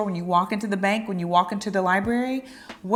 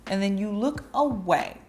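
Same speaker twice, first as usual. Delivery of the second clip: ordinary talk, nothing marked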